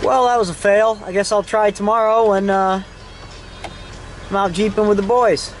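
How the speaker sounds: ordinary delivery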